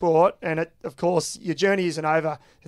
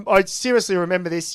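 normal talking voice

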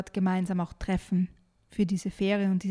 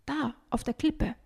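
higher, mildly excited pointing out; a touch of urgency, raised pitch; excited call; 5 semitones higher than usual. Acted. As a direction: point out a sudden discovery. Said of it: "ruft er plötzlich ganz aufgeregt".

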